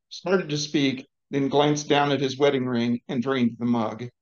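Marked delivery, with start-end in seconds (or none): none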